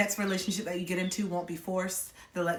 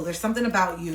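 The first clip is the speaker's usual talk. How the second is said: usual speaking voice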